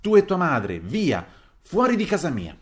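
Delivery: loud, high swoops on each command; angry shout. A narrator's reading. Widow ordering him and his mother out, angry shout. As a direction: throw them out at full voice. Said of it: "mi gridò".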